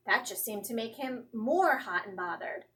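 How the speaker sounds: ordinary delivery, nothing marked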